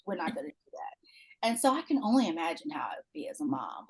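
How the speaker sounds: hushed unvoiced whisper. Real, not acted. Whispering an aside to the others. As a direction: drop to a breathy whisper.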